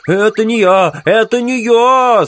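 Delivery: high, loud, drawn-out wail; desperate moaning. Acted. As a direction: wail a frantic denial at full pitch. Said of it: "стонал лейтенант". (отчаянный громкий стон)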